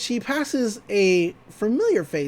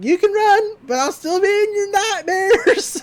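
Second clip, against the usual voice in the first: put-on high, loud crazed old man; high-pitched menacing cackly taunt; Old Man McGucket; 6 dB louder than usual. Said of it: "On account of my horrifying voice".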